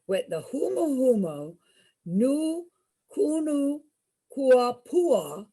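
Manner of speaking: huma huma nu kunu kuapua said syllable by syllable with pauses; slow spelled-out name. Shown as a demonstration. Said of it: "is a real fish".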